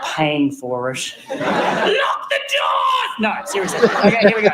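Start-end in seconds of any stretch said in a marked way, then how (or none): 1.3-3.2 mock villain shout. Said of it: "mock trapping the audience".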